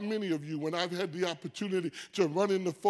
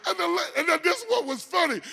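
ordinary talk

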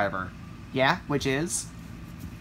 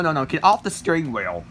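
ordinary talk, nothing marked

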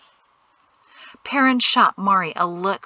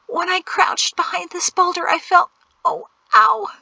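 high-pitched, squirming discomfort; high, pained girlish gasps; gasping distress; 10 semitones higher than usual. A narrator's reading. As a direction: gasp it out, rising to a yelp. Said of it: "Jatesy gasped, squirmed, and gasped again".

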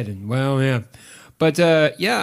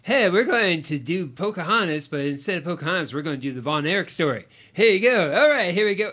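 higher, louder, peppy mock showman; full-on Disney pitch; 5 semitones higher, 5 dB louder than usual. Critic mocks the film's storytelling, chirpy Disney sell. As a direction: pitch it like an eager family-film storyteller. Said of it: "they went full on Disney".

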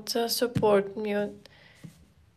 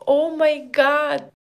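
loud delighted exclamation; excited; 2 semitones higher, 7 dB louder than usual. Real, not acted. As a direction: blurt it out in delight. Reacting to gifts.

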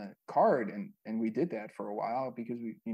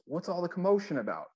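higher-pitched quoted question; imitating Dirty; 8 semitones higher than usual. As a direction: lift the voice for someone else's puzzled question. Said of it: "and he goes".

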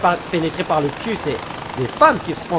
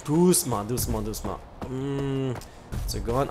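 hushed; 6 semitones lower, 10 dB quieter than usual. Real, not acted. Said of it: "sneaking, telling himself softly".

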